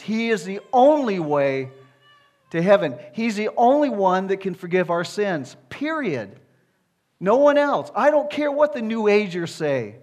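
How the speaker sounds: forceful, emphatic preacher voice; full-force preaching. Real, not acted. Preacher insisting to congregation on Jesus alone, full-force preaching. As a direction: preach it hard, hammering each name.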